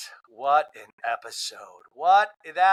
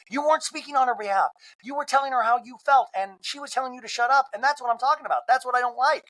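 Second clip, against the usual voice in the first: much higher, worked-up Monica; Monica venting; 10 semitones higher than usual. Retold or imitated. Monica tells Angie what bothers her, much higher. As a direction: push the point hard, pitched up. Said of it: "And Monica's like".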